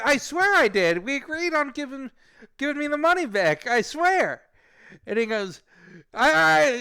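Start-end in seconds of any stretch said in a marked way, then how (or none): 0.0-4.4 high, loud, panicked insistence; re-enacting his rattled protest; nervous pleading. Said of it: "he made me very nervous". 6.1-6.8 high, rapid-fire angry yelling; imitating a caller screaming at him; screaming support guy. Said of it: "guy from DoorDash screamed at me".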